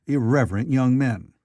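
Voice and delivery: plain reading voice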